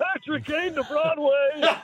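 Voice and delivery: high, drawn-out announcer call; Steve Cooley. Retold or imitated. Analyst mimics colleague announcing the trade, high slow call. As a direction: imitate a sportscaster belting out a big trade. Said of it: "listening to Steve Cooley's".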